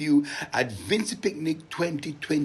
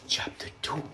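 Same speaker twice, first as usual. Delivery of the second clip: unvoiced, soft chapter announcement; hushed storyteller aside; whispered title; 6 dB quieter than usual. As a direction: lean in and breathe it out.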